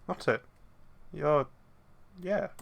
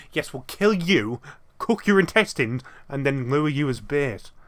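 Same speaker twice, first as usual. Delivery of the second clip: mock menacing threat; 3 semitones higher, 5 dB louder, slower than usual. Joking threat to the old lady, hammed-up menace. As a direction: ham up the threat, dragging each word.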